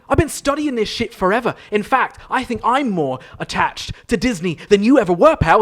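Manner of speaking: huffy improv guest getting defensive; mock offended rant. Guest snaps at the host in mock offense.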